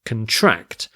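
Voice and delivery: conTRACT, second syllable stressed. Shown as a demonstration. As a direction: shift the stress to the end of "contract", as the verb. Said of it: "the stress shifts to contract".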